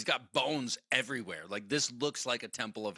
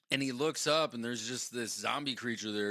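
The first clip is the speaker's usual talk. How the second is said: ordinary talk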